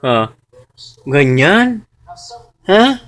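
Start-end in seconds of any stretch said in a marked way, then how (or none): none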